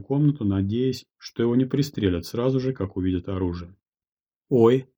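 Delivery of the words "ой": a sudden high loud "oh"; startled exclamation, high and loud; surprised. A narrator's reading. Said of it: "удивлённо сказала Юлия Сахарова".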